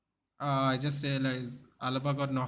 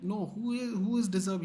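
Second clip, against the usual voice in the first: incredulous high question; 7 semitones higher than usual. Retold or imitated. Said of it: "He's like".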